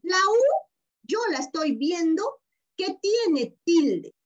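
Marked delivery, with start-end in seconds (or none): 0.0-0.7 "La U" said much higher and louder, hitting the "U"; emphasized. Shown as a demonstration. Teacher pointing out the vowel to her pupils, emphatic. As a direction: point hard at the letter U. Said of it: "Miren, ¿ah?".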